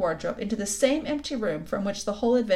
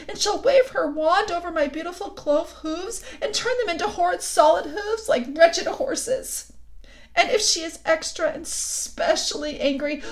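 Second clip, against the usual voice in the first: sobbing out every dreadful fear; crying; 8 semitones higher, 5 dB louder than usual. A narrator's reading. Weeping fawn tells Lucy what the witch will do, high and loud. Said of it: "beginning to cry again".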